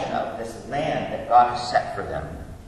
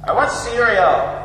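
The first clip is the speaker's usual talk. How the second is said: imitating a bossy little daughter, high and loud; mock-bark it like a demanding kid; barked child's demand; 6 semitones higher, 6 dB louder than usual. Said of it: "she is barking demands".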